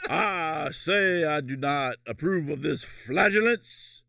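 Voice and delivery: slow, drawn-out Southern objection; Southern gentleman. Acted. Co-host objecting in mock court, slow Southern accent. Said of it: "Why are we doing, like, the South".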